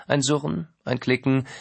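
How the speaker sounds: ordinary delivery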